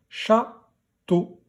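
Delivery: château split into "châ", pause, "teau"; château with a gap between its syllables. Shown as a demonstration. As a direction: say château in two separate high syllables. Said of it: "à la deuxième syllabe".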